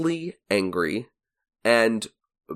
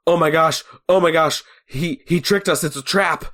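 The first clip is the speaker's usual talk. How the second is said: lightly acted frantic kid, higher pitch; urgent retold alarm, pitched up; panicked Mike; 5 semitones higher than usual. Host quoting Mike realising they were tricked, mock panic. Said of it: "and Mike is going".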